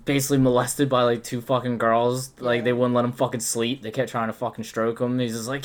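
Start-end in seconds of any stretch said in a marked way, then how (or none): none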